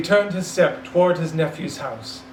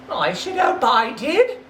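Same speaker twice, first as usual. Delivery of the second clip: lightly voiced street boy; cheeky boy; 8 semitones higher than usual. The boy answering Scrooge, cheeky and sure. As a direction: toss it back with a grin.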